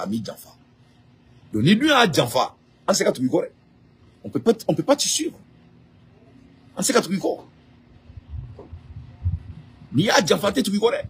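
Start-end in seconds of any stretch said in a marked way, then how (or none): 2.9-11.0 protesting refusal. Real, not acted. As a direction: refuse in a raised pitch.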